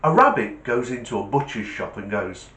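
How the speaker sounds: ordinary talk, nothing marked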